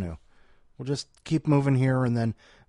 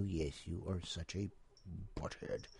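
whisper; 6 semitones lower, 18 dB quieter than usual. Real, not acted. Scolding the cat.

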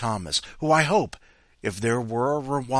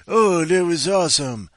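gushing commenter; 7 semitones higher, 8 dB louder than usual. Retold or imitated. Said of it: "people said".

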